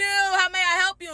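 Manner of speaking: high, loud put-on politeness; mock customer-service greeting. Bride retells answering the rival's call, mock-polite. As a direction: answer the rival with exaggerated helpfulness. Retold snippy phone greeting.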